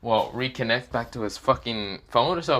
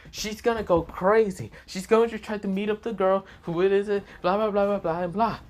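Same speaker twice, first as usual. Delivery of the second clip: flustered rambling; 9 semitones higher than usual. A narrator's reading. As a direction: ramble in a high, exasperated voice. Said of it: "how Issei is rambling".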